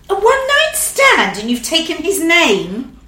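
incredulous, stretching it out; shocked disbelief. Retold or imitated. Co-star hearing her absurd excuse, incredulous and slow. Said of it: "she said".

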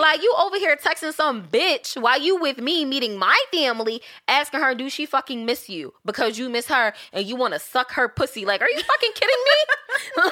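loud, high re-enacted rant; angry confrontation. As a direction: tear into him, rising to incredulous. Acted quarrel, angry rant at ex.

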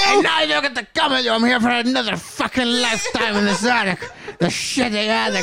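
high, laughing whiny toy voice. Acted. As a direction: complain as the abandoned toy. Toy character complaining.

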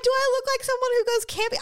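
high, fast mocking quote; mock offended. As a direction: toss it off in scornful disbelief.

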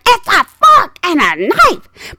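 slow, high, loud mimicked insistence; angry old lady. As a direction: hammer it out like an outraged passenger. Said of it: "these ladies had some anger".

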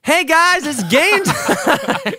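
loud, slow, high show-host hype; hyped announcer shout. Shown as a demonstration. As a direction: belt it like a game show opener. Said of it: "we gotta say".

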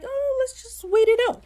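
high mocking whine; mock timid voice. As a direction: squeak it out like a scared captain. Retelling timid Steed's reluctance.

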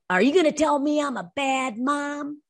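high, defensive challenge; defensive snap. Retold or imitated. Imitating a defensive mother confronted in a store, high snappy challenge. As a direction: throw it back like a cornered mom. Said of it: "she snapped her head like".

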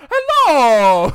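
high, loud cartoon greeting; Mario. Brother picking his sister up from school, Mario voice. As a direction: belt out a game plumber's hello. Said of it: "talking in Mario voice while driving my sister".

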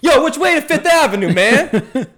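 loud, high holler imitating a passerby; retold yell. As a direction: yell it out across the street, hammed up. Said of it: "he yells out".

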